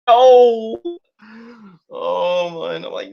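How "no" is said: loud no. Real, not acted. Cringing at the makeover.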